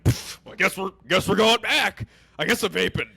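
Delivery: hammed-up inner defeat; mock resignation. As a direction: give up dramatically. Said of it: "In my head, I'm like".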